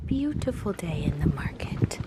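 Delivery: hushed aside; soft whisper. Real, not acted. Vlogger whispering an aside in the market. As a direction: whisper it to the viewers.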